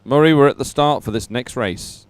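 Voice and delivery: ordinary talk, nothing marked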